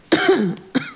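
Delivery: a sudden choking cough, caught off guard; startled cough. Acted. Woman hearing a crude toast, startled cough. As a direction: cough as if the wine went down wrong. Shocked by his blunt toast.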